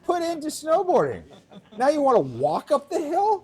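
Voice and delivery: high, loud mock-outraged old-timer rant. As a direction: rant like an indignant snowboard veteran. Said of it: "Time for a rant".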